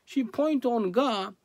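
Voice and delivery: ordinary delivery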